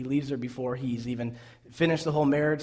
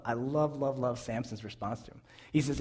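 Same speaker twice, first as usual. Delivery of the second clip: usual speaking voice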